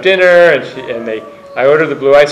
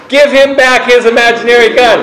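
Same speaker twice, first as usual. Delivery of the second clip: much higher and louder, a mother yelling across the house; 9 semitones higher, 5 dB louder than usual. Retold or imitated. Mother yelling at her other son across the kitchen, loud. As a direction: shout the order without thinking. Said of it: "she yells to the other son".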